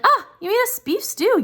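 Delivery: high, pleased husband voice; husband's cheery praise. Retold or imitated. Husband praising his wife's stew, pleased and high. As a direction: play the delighted husband.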